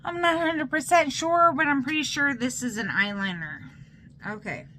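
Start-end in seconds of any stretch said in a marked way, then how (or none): 0.1-3.6 excited. Real, not acted. Rushed excited guess.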